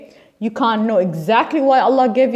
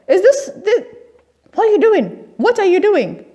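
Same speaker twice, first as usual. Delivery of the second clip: outraged rebuke; 10 semitones higher, 3 dB louder than usual. Real, not acted. Outraged rebuke of mosque-goers.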